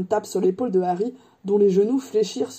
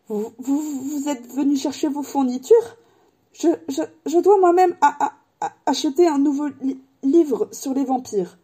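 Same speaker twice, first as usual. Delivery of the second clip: raised, jittery voice repeating syllables; nervous stammer; 6 semitones higher than usual. A narrator's reading. Professor terrified at buying a vampire book, stammering. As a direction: stutter through your errand, dreading it. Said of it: "balbutia le professeur".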